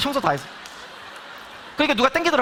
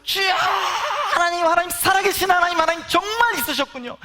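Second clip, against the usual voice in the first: tearful shout of discovery; crying out; 10 semitones higher than usual. Retold or imitated. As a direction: call out to God sobbing with joy. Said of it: "막 울면서".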